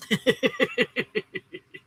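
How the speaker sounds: light run of giggles; amused giggle; chuckling laugh. Real, not acted. Laughs after answering a viewer.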